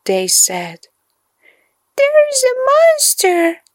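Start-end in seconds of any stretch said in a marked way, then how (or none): 2.0-3.6 sobbing. A narrator's reading. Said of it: "he sobbed".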